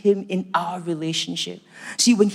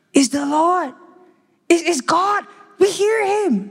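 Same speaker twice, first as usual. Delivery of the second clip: much higher and louder, breathless awe; awestruck excitement; 9 semitones higher, 4 dB louder, slower than usual. Acted. Believers behind the door thrilled to hear the Lord, awestruck excitement. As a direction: squeal it like you can't believe it.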